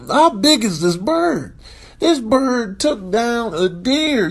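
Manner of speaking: ordinary talk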